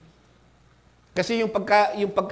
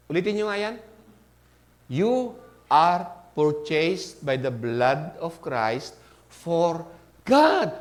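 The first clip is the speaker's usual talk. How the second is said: ordinary talk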